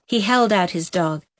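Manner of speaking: ordinary delivery, nothing marked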